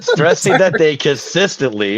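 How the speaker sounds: reading while holding back laughs; stifling laughter. Real, not acted. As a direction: try not to crack up mid-read. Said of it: "I'm trying not to laugh here".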